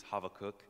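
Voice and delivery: Habakkuk said the Hebrew way, with a v sound; Hebrew pronunciation. Shown as a demonstration. Preacher showing the congregation the Hebrew, Habakkuk pronounced Hava-kook. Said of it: "if you wanna do the Hebrew".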